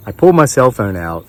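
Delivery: ordinary talk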